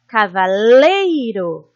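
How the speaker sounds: cavaleiro slowly, with a loud, long lei; lengthened stressed syllable. Shown as a demonstration. Teacher showing pupils the strongest syllable, drawing out lei in cavaleiro. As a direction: swell and hold lei in cavaleiro. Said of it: "a sílaba mais forte a gente se demora".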